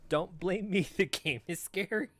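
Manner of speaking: high, loud, giggly protest; excited laughing excuse. Real, not acted. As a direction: laugh it off loudly.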